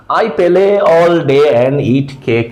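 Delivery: "play" as pe-lay, "day" and "cake" without glides; thick learner's Bangla accent on every word; Bangla-accented English. Shown as a demonstration. Teacher showing how foreigners hear learners, Bangla-accented English. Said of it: "এভাবে বলে আসলে যখন বিদেশে শুনে".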